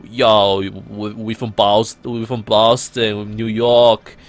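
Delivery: slow, drawn-out New York accent. Shown as a demonstration. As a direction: stretch the vowels like a mock New Yorker. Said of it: "In New York accent, you got, like".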